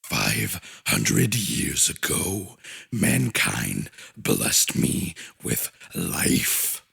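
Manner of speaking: very deep, slow, distorted titan voice; a vast creator confiding its loneliness, slow and low; ancient lonely god. A narrator's reading. The Overmind explains its past to the abomination, very deep and slow.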